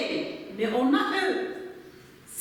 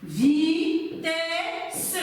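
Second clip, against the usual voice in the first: vitesse drawn out as "vi - tè - sse"; segmented syllables. Shown as a demonstration. Teacher counting syllables for pupils, vitesse said piece by piece. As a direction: break vitesse into held, separate syllables. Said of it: "deux syllabes".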